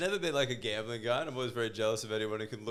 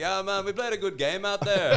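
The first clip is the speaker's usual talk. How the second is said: mock West Indian accent, higher, louder and laughing; 7 semitones higher, 5 dB louder than usual. Friend imitating old-school West Indies cricketer, laughing West Indian accent. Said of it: "had that accent".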